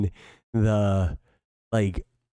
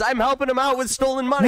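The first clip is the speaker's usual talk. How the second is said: mocking politician voice; 11 semitones higher, faster than usual. Mock politician continues.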